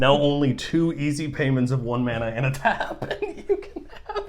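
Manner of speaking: loud, high infomercial pitch; hard sell ad. Pretend. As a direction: sell it like a late night infomercial. Mock ad pitch with price.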